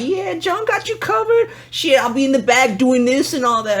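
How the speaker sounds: very high imitation of a girl; high mock-feminine voice; girl dancer. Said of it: "your girl be like".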